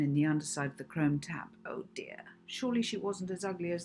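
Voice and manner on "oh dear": whispered dismay. Acted. Molly dismayed at reflection.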